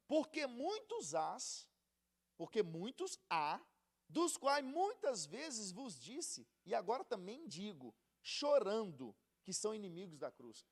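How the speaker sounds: slow, weighty reading of Paul's words; scripture reading. Acted. Preacher reading Paul's letter to the youth, slow and solemn. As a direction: read each phrase slowly, like holy writ. Reads the scripture passage aloud.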